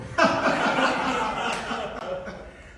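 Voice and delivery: big high delighted laugh; loud laugh. Real, not acted. Host laughing loudly at the music.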